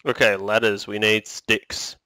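ordinary delivery

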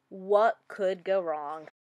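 ordinary delivery, nothing marked

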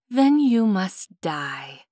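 slow, sighing mock sadness. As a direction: drag it out with a heavy sigh. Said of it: "he sighed".

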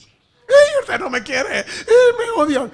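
high, loud mock wailing; hammed-up sobbing complaint; wailing bride. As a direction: cry it out like a spoiled bride. Bride wails at her mother.

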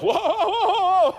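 long, high, loud group whoop; jeering crowd "whoa". Retold or imitated. All the kids after René insults Caber, loud hooting. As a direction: hoot like kids egging on a fight.

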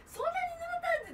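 very high, slow amazed question; mock surprise. Friend retells her own surprise at the soaked pair. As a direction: stretch it out in disbelief.

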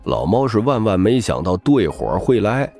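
usual speaking voice

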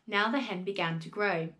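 plain reading voice

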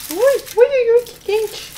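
high yelps at the heat; yelp, too hot to hold; burnt fingers yelp. Real, not acted. Burning fingers on hot pastry.

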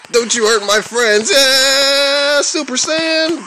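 rising high anime battle cry; hammed-up high shout; mock power-up. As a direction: power up like a Super Saiyan.

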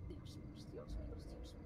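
whispered frightened pleading; panicked whisper. Real, not acted. Player whispering in panic as danger nears. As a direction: gasp the words without voice.

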